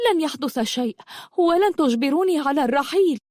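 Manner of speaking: high-pitched, stubborn protest; defiant refusal. Acted. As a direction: refuse to be pushed. Girl refuses to be forced away. (رفض عنيد بنبرة عالية)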